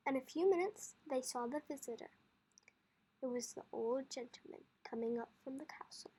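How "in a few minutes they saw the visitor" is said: ordinary delivery, nothing marked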